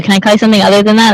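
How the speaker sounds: ordinary talk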